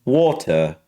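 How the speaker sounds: British "water" with crisp T, no final R; British accent. Retold or imitated. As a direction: imitate a British person saying "water". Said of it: "when a British person says it".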